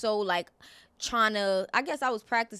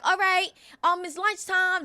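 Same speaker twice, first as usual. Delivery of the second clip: higher, lightly voiced teacher announcement; play teacher; 6 semitones higher than usual. Playing teacher to cousins, lightly put-on voice. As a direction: announce it to a pretend class.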